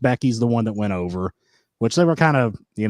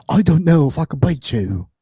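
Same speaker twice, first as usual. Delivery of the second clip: a bad stab at an Irish accent, pitched up and louder; failed Irish accent; 6 semitones higher, 5 dB louder than usual. Co-host attempts Becky Lynch in an Irish accent. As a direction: try an Irish accent and miss it. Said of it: "I can't do an Irish accent anymore".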